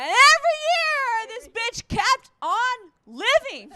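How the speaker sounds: high, loud, slow fake sob; mock wail. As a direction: wail it like a hammed-up mourner. Mock wail about grandma living.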